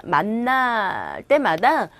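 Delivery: usual speaking voice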